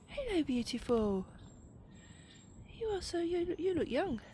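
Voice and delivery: high, sing-song cooing voice; baby talk to a squirrel. Acted. Vlogger coos at a squirrel peeking from a tree, high sing-song baby talk. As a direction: coo sweetly at a little animal.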